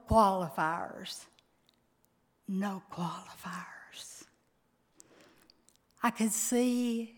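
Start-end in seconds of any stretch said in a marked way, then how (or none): 2.5-4.2 soft breathy whisper, no voice at all; husband's whisper. Retold or imitated. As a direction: breathe it like a dying husband's last plea. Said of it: "He'd look at me and he'd say".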